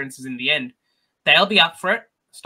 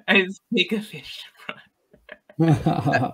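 breathy laugh-whisper of the pun; whispered through laughter; 4 dB louder, slower than usual. Real, not acted. Teasing a co-host's pun, whispering through laughter. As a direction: giggle the words out under your breath.

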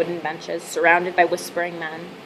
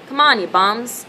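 a restrained shout from the crowd, higher and louder; heckler's shout; 4 semitones higher, 5 dB louder than usual. A narrator's reading. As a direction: call it out like an impatient spectator. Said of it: "someone shouted".

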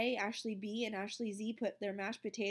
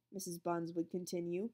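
ordinary delivery, nothing marked

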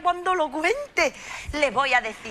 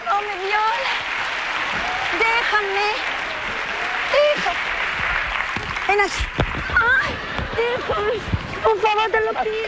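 high, drawn-out, overwrought begging; hammed-up desperate begging; melodramatic pleading; 4 semitones higher, slower than usual. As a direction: plead like a tragic heroine.

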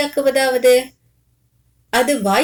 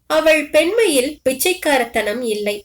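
ordinary delivery, nothing marked

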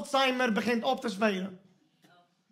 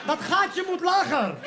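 laughing shout; 9 semitones higher than usual. Real, not acted. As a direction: yell through laughter at the too-high peephole. Complaining about the peephole height.

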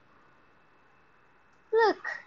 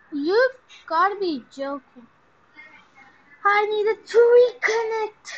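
exasperated outburst; 7 semitones higher, 11 dB louder than usual. Real, not acted. Game fails to respawn ship.

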